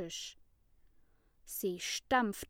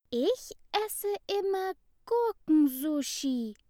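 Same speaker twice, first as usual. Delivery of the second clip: put-on high, louder little girl voice; girl's voice; 10 semitones higher, 5 dB louder than usual. A narrator's reading. A classmate chimes in about her lunch, girlish voice. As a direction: pipe up brightly as a small girl. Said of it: "meldete sich das japanische Mädchen".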